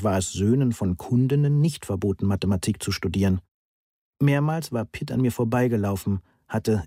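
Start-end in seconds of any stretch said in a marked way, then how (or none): none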